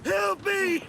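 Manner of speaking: high, frantic scream for help from afar; desperate cry for help. Acted. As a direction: shriek for help as if a beast is on you. Villager screams for help offscreen.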